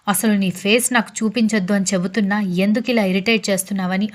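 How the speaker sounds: usual speaking voice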